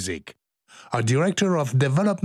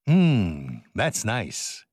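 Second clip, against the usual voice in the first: ordinary talk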